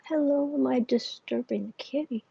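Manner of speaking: soft whisper to the cat; hushed whisper to a sleepy cat. Real, not acted. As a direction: whisper so as not to wake the kitty.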